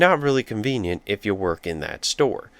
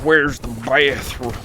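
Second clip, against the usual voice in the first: put-on "Where's the bathroom?" in a slow, light Southern accent; lightly put-on Southern drawl, desperate; 5 semitones higher, 3 dB louder, slower than usual. UPS driver pleading for a bathroom, slow light Southern accent. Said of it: "I don't know why I made him Southern".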